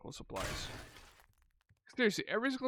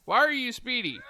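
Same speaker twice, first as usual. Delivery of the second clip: much higher, louder alarm; alarmed surprise; 9 semitones higher, 7 dB louder than usual. Real, not acted. Player alarmed by a speedy zombie, high loud yelp.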